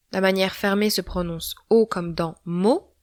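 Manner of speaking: usual speaking voice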